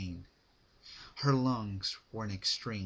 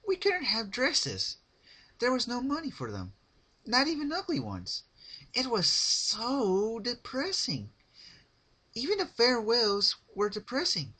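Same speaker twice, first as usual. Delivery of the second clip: high, loud chirpy character voice with sad undertone; Pinkie Pie voice; 14 semitones higher, 6 dB louder than usual. A narrator's reading. Pinkie telling Rarity about her father, high chirpy voice. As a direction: chatter on cheerfully about something sad.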